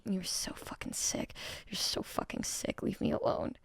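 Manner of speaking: hushed, breathy whisper, half disgusted. Pretend. Streamer whispering back at a creepy chat joke. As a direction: whisper it as if recoiling from something gross.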